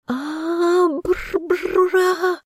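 high, gurgling cry for help; choking on water. A narrator's reading. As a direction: shout while swallowing water. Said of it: "захлёбываясь, прокричала Яна".

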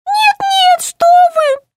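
very high squeaky toy voice; squeak. Acted. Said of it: "пискнул гном". (писклявый голосок гнома)

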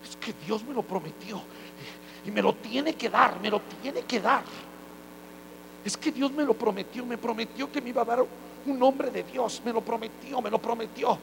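soft, insistent whining mock believer; a hint of a petulant plea; whiny believer. Believer whining about God's promise. (creyente quejumbroso, en voz baja)

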